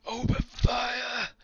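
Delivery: very high, loud, drawn-out yell; yelled command. Acted. General ordering the missile volley, yelled command. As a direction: stretch the command into a long, high shout. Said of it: "and then he yells".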